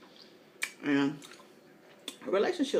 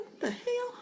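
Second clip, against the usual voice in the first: incredulous; 4 dB quieter than usual. Retold or imitated. Retelling her shocked reply.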